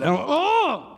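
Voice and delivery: high, drawn-out annoyed "Oh". Retold or imitated. Dad stuck in ditch, high fed-up "Oh". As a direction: sigh it out, fed up. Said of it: "So I said".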